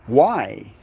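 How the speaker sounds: usual speaking voice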